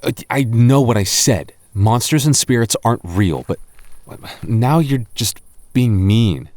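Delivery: ordinary talk, nothing marked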